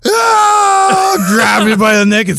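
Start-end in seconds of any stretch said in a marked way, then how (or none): 0.1-1.3 sudden high startled scream; retold scream. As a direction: yelp in fright at a snake. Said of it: "And I was like".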